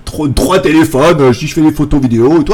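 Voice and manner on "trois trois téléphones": ordinary talk, nothing marked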